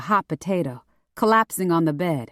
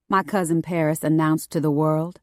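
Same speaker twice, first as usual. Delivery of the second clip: usual speaking voice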